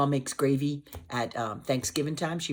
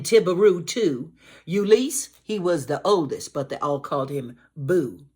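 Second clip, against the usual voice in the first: lively, higher, louder read-aloud; storybook reading; 4 semitones higher, 4 dB louder than usual. Acted. Teacher reading a Cajun rhyme aloud to children. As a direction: read the rhyme with bouncing energy.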